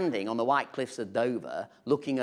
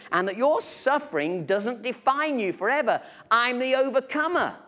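a lightly voiced Jesus, raised and earnest; voicing Jesus; 8 semitones higher, 4 dB louder than usual. Jesus speaking to his disciples, raised and earnest. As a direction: speak as Jesus, urging and promising. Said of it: "Jesus is saying to the disciples".

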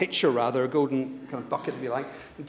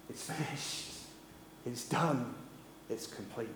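very soft, lower, separated by pauses; hushed last words; 5 semitones lower, 14 dB quieter, slower than usual. Retold or imitated. Jesus breathing his last on the cross, hushed last words. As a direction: breathe out each short phrase quietly.

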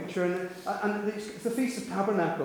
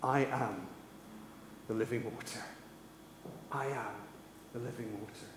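very quiet, lower, with long pauses; hushed declaration; 6 semitones lower, 14 dB quieter than usual. Retold or imitated. Jesus standing up before a silenced crowd, hushed declaration. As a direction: let each phrase drop softly into silence.

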